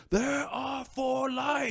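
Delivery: slow, high, drawn-out shouted line; Picard quote. Retold or imitated. Recalling Chain of Command, slow high shouted Picard line. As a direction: shout it slowly like a tortured captain.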